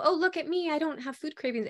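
high, sing-song mock boast; lightly mocking a smug know-it-all. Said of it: "this magical".

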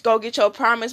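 ordinary talk, nothing marked

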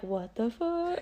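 stunned. Pretend. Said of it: "Gabby's gonna call me like".

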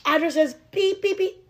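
high, loud mock bleep; mock censor beep. Jokingly censoring her address.

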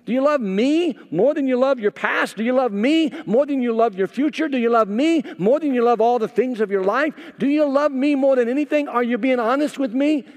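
loud, high, pleading preacher questions; impassioned sermon delivery; preaching at full force. Acted. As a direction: press the questions hard like Jesus to Peter. Preacher voicing Jesus to Peter.

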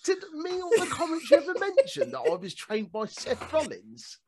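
English Squidward voice. Retold or imitated. Co-host mocking Nathan Fraser bragging about Seth Rollins, high and nasal. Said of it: "English Squidward voice".

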